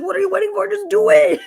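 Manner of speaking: high, loud exasperated shout; mock angry yell. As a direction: yell it like he's fed up. Retelling his angry outburst.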